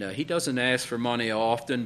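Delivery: ordinary delivery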